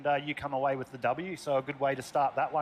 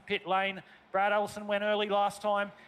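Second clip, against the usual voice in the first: raised, excited race commentary; live play-by-play; 5 semitones higher than usual. Acted. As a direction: call the race as it unfolds.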